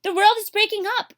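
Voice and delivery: loud, high alarm; panicked shout. Acted. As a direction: cry the warning in terror. Boars warn the deer.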